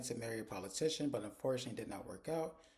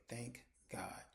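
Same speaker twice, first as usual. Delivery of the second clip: soft, under-the-breath relief; retold relief; 9 dB quieter than usual. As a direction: let it out quietly after escaping. Relieved after escaping awkward room.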